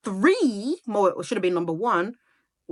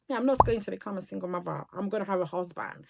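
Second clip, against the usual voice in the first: softer and quick, as if to herself; quiet inner thought; 2 semitones lower, 8 dB quieter than usual. Retold or imitated. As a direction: murmur the old resolve quickly to yourself. Retells her own youthful resolve.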